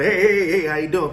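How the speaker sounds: high, loud, hyped-up hello; imitating himself pouncing on strangers; overeager greeting. Greeting strangers in the parking lot, high and overeager. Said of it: "I'm coming at them with this energy".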